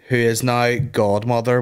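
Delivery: ordinary delivery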